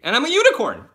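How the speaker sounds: high, loud, exuberant announcement; gleeful proclamation. Acted. Host reading out a fan's compliment, gleeful and loud. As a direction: belt it out with playful glee, pitch soaring.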